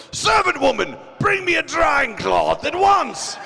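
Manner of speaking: high, loud, slow commanding shout; Vegeta voice. Retold or imitated. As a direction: bark the order like a prince. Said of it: "He's like".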